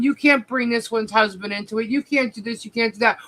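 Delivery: ordinary talk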